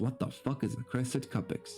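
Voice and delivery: ordinary delivery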